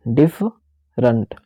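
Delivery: डिफरेंट said slowly with a weak middle schwa; careful slow डिफरेंट; slow reduced vowel in different. Shown as a demonstration. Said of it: "देखो यहाँ पे क्या पढ़ रहा हूँ मैं".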